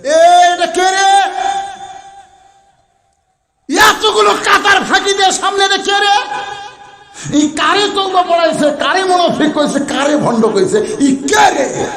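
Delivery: high-pitched, shouted challenge; imitated crowd outcry. All the companions crying out at the accuser, a high retold shout. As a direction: cry out high, as a crowd demanding the culprit. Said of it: "সমস্ত সাহাবীরা চিল্লায় উঠছে".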